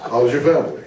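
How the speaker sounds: party small talk in broadcaster tones; stuck announcer voice. Acted. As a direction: greet a friend like a TV presenter. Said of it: "you get into this voice".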